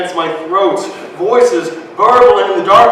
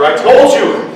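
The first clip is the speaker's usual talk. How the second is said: angry protest; 5 semitones higher, 6 dB louder than usual. Acted. Boy protesting after muttering.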